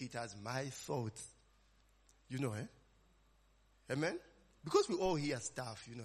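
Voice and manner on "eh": ordinary delivery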